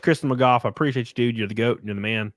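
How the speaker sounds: normal talking voice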